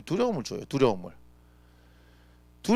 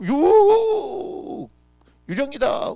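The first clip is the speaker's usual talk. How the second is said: terrified shriek; 11 semitones higher, 5 dB louder, much slower than usual. Acted. Disciples seeing a ghost.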